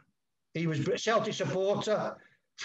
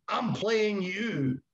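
put-on slow, higher Glasgow Scottish accent; lightly imitated Scottish accent, drawn out; 4 semitones higher, much slower than usual. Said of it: "And he said in his Scottish accent".

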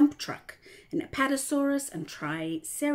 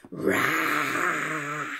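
low, loud roar; dinosaur roar; 5 semitones lower, 6 dB louder than usual. A narrator's reading. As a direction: roar like a tyrannosaurus. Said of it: "said Tyrannosaurus".

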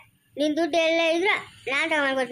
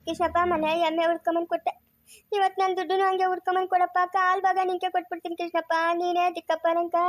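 tearful pleading. Acted. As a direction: beg for help while wailing. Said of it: "ನೀನ್ ಅಳ್ಬೇಡ ಜಯಮ್ಮ". (ಅಳುತ್ತಾ ಬೇಡಿಕೊಳ್ಳುವುದು)

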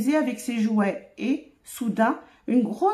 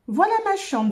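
put-on proud little girl, pitched higher; 7 semitones higher than usual. A narrator's reading. Camille shows friends her room, proudly. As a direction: show it off proudly, voice lifted. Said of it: "toute fière".

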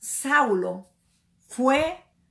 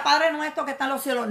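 rattled-off rote prayer; 2 semitones higher, much faster than usual. Retold or imitated.

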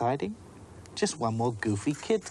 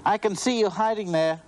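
high, louder teasing sing-song; teasing call; 11 semitones higher, 5 dB louder than usual. Acted. Abigail calling to the hiding girl, high teasing call. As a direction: call out playfully to a hiding child. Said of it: "She called out teasingly".

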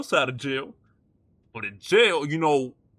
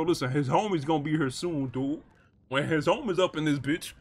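normal talking voice

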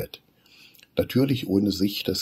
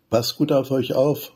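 higher, louder call after them; 7 semitones higher, 6 dB louder than usual. Acted. Mama calling after the children as they leave. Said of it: "ruft Mama Wichtel noch".

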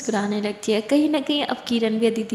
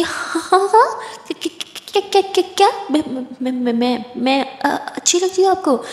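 high, stammering, caught completely off guard; flustered surprise; 5 semitones higher, 4 dB louder than usual. Acted. Kiran, stunned by the compliment, stammers in high flustered disbelief. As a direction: stutter higher and louder in shock.

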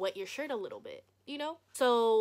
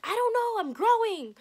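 loud, high-pitched retort; retold flustered outburst; defensive yell; 13 semitones higher, 9 dB louder than usual. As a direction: shout the excuse back. Shouting back at dad.

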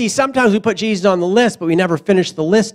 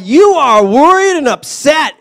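slow, loud, exasperated rebuke; Jesus chiding; 4 semitones higher, 4 dB louder than usual. Retold or imitated. Jesus rebuking anxious Martha, loud and slow. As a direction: scold like a fed-up teacher. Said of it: "the Lord replied".

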